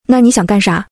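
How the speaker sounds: plain reading voice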